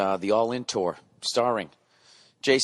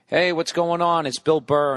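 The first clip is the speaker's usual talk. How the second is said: podcast intro; 7 semitones higher, 4 dB louder than usual. Acted. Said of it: "podcast intro".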